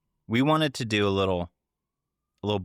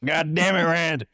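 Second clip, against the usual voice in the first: higher, louder Southern cussing; Southern accent; 9 semitones higher, 5 dB louder than usual. Retold or imitated. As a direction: yell it like an angry Southern sheriff. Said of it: "Southern accents".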